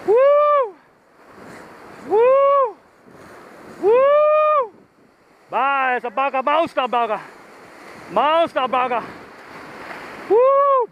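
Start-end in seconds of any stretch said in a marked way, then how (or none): 0.1-4.7 string of high, loud whoops; thrilled yells, one after another; excited whoops. Real, not acted. Repeated whoops as fish runs. 10.3-10.9 high, loud whoop of real thrill; sudden joyful yell; excited whoop. Real, not acted. Fish running line out.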